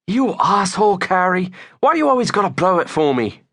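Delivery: high-pitched, aggrieved whine; Jezza. A narrator's reading. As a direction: whine at the friend who spoiled it. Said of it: "Jezza whined".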